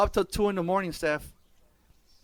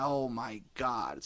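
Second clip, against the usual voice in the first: weary oh my God; 5 semitones lower, 8 dB quieter, much slower than usual. Retold or imitated. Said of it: "I'm like".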